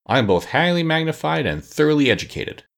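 earnest self-praise, pitched high. A narrator's reading. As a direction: announce credentials with conviction. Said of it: "said the Wogglebug earnestly".

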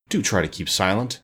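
plain reading voice

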